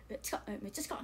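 ひそひそと驚いた声; 声を落として近さに驚く; 小声のまね. Retold or imitated. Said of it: "小声で。". (小声で驚く)

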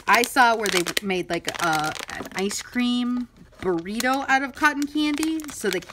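ordinary delivery

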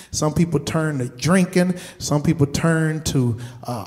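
normal talking voice

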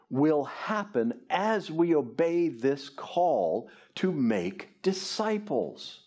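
usual speaking voice

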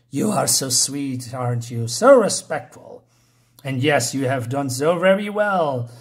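indulge your servant like a scheming queen; condescending queen, pleased. Acted. Voices the queen praising her servant.

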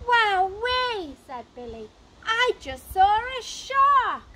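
high, loud and slowed in wonder; amazed child. A narrator's reading. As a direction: draw it out in awe. Child amazed at imagined shark.

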